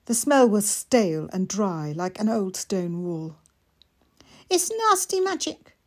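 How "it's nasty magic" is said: put-on high, eager small boy; little boy voice. Mikey asking his sister, high and eager. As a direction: ask it wide-eyed like a small child.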